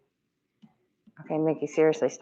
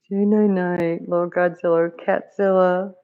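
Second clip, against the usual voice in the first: sing-song pet voice, slow and a bit louder; pet-talk voice; 3 semitones higher, 4 dB louder than usual. Real, not acted. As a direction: croon a bedtime goodnight to the cat. Talking to cat at bedtime.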